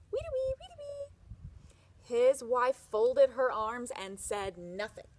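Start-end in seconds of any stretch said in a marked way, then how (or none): 0.1-1.1 very high bird-like whistle call; whistling birdsong. Acted. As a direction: chirp it like a bird to cheer her. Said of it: "he whistled to the birds".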